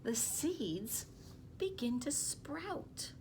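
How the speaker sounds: storybook reading voice, lively; gentle read-aloud to young listeners; reading to children. Acted. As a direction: read it to the class like a picture book. Teacher reads picture book aloud.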